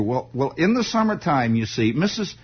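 usual speaking voice

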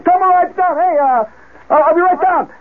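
high, stumbling yell downstairs; frantic holler. Retold or imitated. Bleeding man shaving calls downstairs, frantic high holler. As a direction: holler it while flustered mid-shave.

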